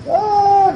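very high drawn-out wail; imitated "aah" of sobbing; mock crying wail. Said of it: "otra vez Yossi llorando".

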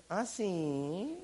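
put-on low, quiet child's "oh, really"; soft, drawn-out sly kid retort; defiant little boy. Scolded boy answers his mother, quietly defiant. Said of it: "El niño se voltea y dice".

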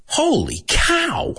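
high, drawn-out astonishment; amazed exclamation. Shown as a demonstration. Host demonstrating a popular expression, amazed exclamation. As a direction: stretch it slowly and pitch it way up.

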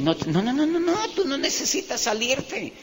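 soft, high-pitched string of protests; acted-out protest, rising high but kept soft; alarmed dissuasion. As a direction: talk a friend out of it, voice climbing high. Retells dissuading friend from quitting.

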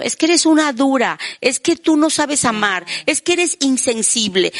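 high accusing reproach; mock accusation. Partner accuses the other of not loving, mock reproach. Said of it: "le estás diciendo".

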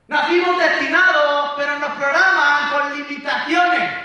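building from loud to full shout; rising shout. Real, not acted.

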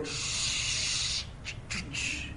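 long shush, then short repeated shushes; urgent hushing. Retold or imitated. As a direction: hush someone who said a forbidden word. Hushing the woman in story.

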